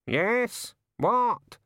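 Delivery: high squawky bird voice; sharp high query from a raven; impatient raven. A narrator's reading. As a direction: answer like an interrupted bird. Raven answers the rat.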